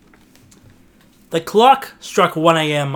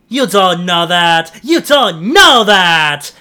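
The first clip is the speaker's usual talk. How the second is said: slow, loud, rising insistence; loud cry; 3 semitones higher, 10 dB louder, slower than usual. Acted. Teddy protesting to Noah, slow and loud. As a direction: hammer it louder the second time. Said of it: "cried Teddy".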